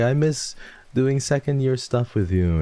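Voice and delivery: high, gushing quoted voice; sweet imitation. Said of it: "was so sweet and was like".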